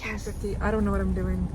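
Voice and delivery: usual speaking voice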